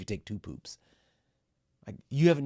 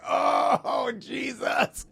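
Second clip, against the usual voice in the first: exasperated shout; 13 semitones higher, 5 dB louder, much slower than usual. Retold or imitated. Only one person can row.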